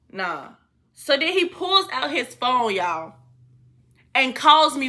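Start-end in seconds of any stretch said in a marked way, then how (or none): 0.1-0.5 man's low voice. Retold or imitated. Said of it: "And then he goes".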